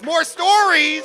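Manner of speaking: loud, high, drawn-out hype call; a slow, loud cheer to the room; hype shout. Real, not acted. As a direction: whip the crowd up. Host hyping the crowd.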